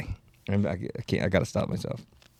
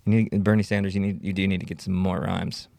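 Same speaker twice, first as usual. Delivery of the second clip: usual speaking voice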